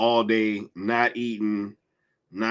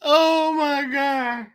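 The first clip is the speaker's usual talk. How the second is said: very slow, much higher, breathless; laughing disbelief; much slower than usual. Real, not acted. Streamer reacting to chat, high breathless disbelief. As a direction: drag it out high, still recovering.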